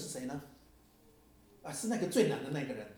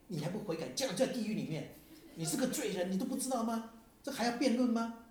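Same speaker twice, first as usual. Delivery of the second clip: mock condemning preacher; 8 semitones higher than usual. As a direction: parody their self-righteous scolding. Prisoner mimics the preachers' condemnation.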